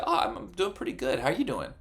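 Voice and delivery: high, bright put-on cheer covering a mess; fake cheerful. Someone hiding their failure from a friend, forced cheerful deflection. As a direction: brush off the question with forced brightness. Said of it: "And you say".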